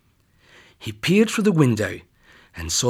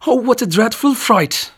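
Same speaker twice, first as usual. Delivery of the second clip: much higher, louder shaken cry; frightened cry; 10 semitones higher, 6 dB louder than usual. Acted. Scared girl hugs her grandma, high frightened cry. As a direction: still scared, blurt it out.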